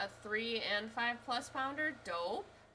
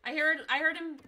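loud, higher alert; urgent shout; 5 semitones higher, 11 dB louder than usual. Real, not acted. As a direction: yell the warning. Urgent callout.

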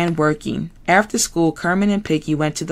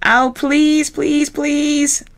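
much higher pleading bear; begging Fozzie; 10 semitones higher, much slower than usual. Acted. Fozzie begging Kermit, high pleading. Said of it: "begged Fozzie".

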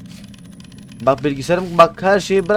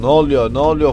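louder, panicked repeat; startled loud cry at the screen; alarmed shout; 6 dB louder than usual. Real, not acted. Repeats question with rising alarm.

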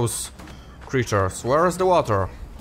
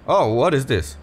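ordinary delivery

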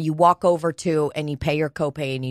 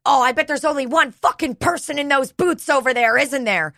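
mock losing it; 8 semitones higher than usual. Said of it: "she starts losing it".

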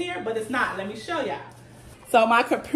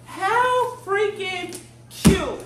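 gushing; 7 semitones higher, 3 dB louder, much slower than usual. Real, not acted. Gushing over a chair.

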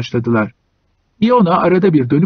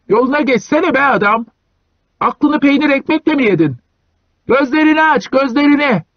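much higher shouted warning; voice from the dark; 11 semitones higher than usual. A narrator's reading. Passerby yelling at Iona from the dark, high shout. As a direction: yell at the sleigh driver in the way. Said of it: "Karanlıkta bir ses yükseldi".

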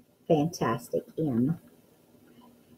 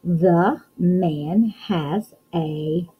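very slow, word by word with a rising end; slow reading aloud; much slower than usual. Shown as a demonstration. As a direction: read each word slowly, leaving it hanging. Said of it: "let's read".

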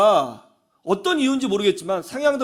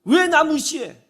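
high-pitched mock protest; acted angry indignation; 8 semitones higher, 3 dB louder, slower than usual. Preacher quoting the cause of anger, indignant protest. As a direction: bristle like someone who feels slighted. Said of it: "무시하는 것이 분노의 원인".

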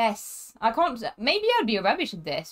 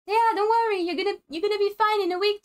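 high cheering-up voice; 8 semitones higher, faster than usual. Pretend. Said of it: "You have to cheer me up".

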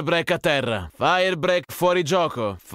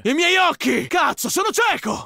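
pained yell; 12 semitones higher than usual. Acted. Soldier blinded by a flashbang cries out in pain. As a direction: yell out, eyes burning.

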